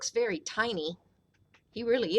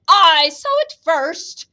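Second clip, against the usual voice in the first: bossy elephant; 5 semitones higher, 11 dB louder than usual. A narrator's reading. Elephant claims the crown.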